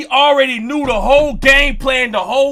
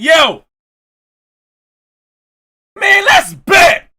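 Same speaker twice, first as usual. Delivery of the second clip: high, loud and slow, drawn-out challenge; challenge shout; 8 semitones higher, 6 dB louder, slower than usual. Retold or imitated. Daring the player to a bet, loud and drawn out. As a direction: call him out, stretching it.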